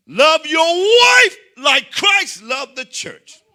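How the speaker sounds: slow, high, loud preacher voice; full-force sermon delivery; preaching shout. Acted. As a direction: preach it to the rafters. Preacher quoting the Bible.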